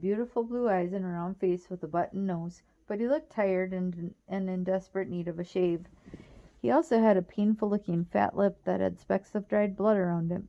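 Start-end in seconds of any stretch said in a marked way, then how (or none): none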